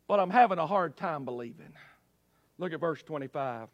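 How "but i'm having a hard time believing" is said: lower, softer, quicker confession; low admission of doubt. Acted. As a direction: drop down and admit the doubt. Preacher admits doubt after loud cry.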